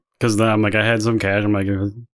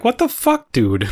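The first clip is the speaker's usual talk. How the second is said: sudden high pitched what the fuck; retold outburst; 12 semitones higher, slower than usual. Retelling a pizza guy with no utensils, high disbelief. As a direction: yelp it in disbelief at the delivery guy. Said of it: "I'm like".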